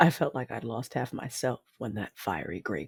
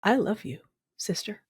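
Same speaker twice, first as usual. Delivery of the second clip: holding back tears; 6 semitones higher than usual. Acted. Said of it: "without my voice shaking".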